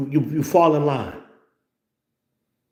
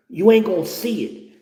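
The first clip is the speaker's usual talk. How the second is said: ordinary talk, nothing marked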